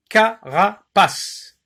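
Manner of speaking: "Carapace" drawn out slowly as ca-ra-pace; slow syllables. Shown as a demonstration. Teacher dictating a word for syllable counting. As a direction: stretch "Carapace" so each beat is heard. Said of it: "vous entendez combien d'unités de son".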